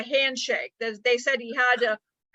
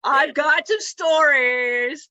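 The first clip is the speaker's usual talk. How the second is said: loud, very drawn-out delivery; hammed-up belting; 2 semitones higher, 6 dB louder, much slower than usual. Friend answering "play us out", loud drawn-out showboating. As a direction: belt it out slowly, stretching each word.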